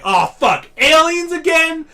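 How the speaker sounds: loud, slow hammed-up dismay; mock fed-up disbelief; mock exasperated. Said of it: "And it's like".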